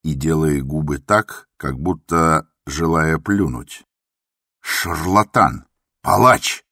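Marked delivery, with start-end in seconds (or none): 4.6-6.6 louder, slow hurled insults; furious insults. A narrator's reading. The patient hurls insults at the doctor, furious. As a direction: fling each name at him.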